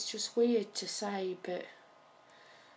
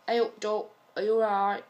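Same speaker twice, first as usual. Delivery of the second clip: lively, louder Nottingham greeting; Nottingham saying; 3 semitones higher, 6 dB louder, faster than usual. Shown as a demonstration. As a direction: call out a friendly greeting in Nottingham dialect. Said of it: "the favourite saying is".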